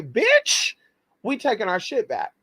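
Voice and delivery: sudden high, sassy "Bitch" that falls back to normal; sassy ancestor voice. Retold or imitated. The ancestors reclaim their money, a high sassy snap. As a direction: play the ancestors snatching it back.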